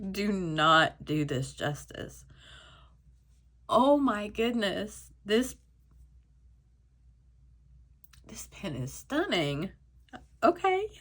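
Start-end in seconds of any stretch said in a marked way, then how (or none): 3.7-9.7 teary awe. Real, not acted. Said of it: "Let's stop being a mess here".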